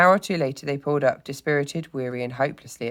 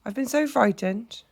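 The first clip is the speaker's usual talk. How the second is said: high, slow, shaken mole voice; frightened confession; 9 semitones higher than usual. A narrator's reading. Trembling mole to rat who found him, frightened. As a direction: confess your fright slowly, pitched high. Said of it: "exhausted and still trembling".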